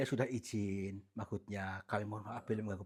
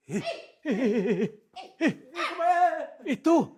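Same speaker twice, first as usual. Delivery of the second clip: loud, much higher frightened yelps; panicked shout; 11 dB louder than usual. Real, not acted. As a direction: yell in fright at something flying past. Panic as something flies past. (teriak panik ketakutan)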